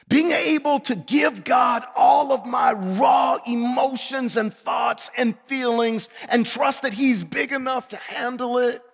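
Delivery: loud, high, driving sermon voice; fervent preaching. Acted. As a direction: preach it out full force. Preaching at full force.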